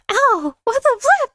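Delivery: high startled cry; shocked outburst. Real, not acted. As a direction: yelp it out in shock. Hit again by cat.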